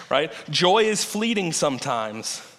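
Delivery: ordinary talk, nothing marked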